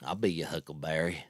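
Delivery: low, soft gunslinger voice; low cowboy line; Doc Holliday drawl. Retold or imitated. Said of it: "I gotta do the Wyatt Earp".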